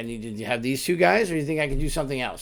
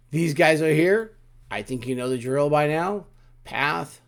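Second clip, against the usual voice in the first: usual speaking voice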